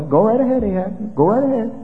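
mock encouragement with exaggerated, swooping pitch; sarcastic prophet. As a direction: humour the king, plainly not meaning it. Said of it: "detected the tone of his voice".

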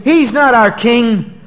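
preacher voicing the crowd rejecting Jesus; angry crowd. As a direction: throw the rejection back as the mob. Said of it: "They're saying".

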